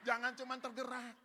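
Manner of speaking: loud, high rallying line; emphatic exhortation. Shown as a demonstration. Preacher having the congregation tell their neighbours, emphatic and loud. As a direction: drive it home to the crowd. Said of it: "ngomong kiri kanan".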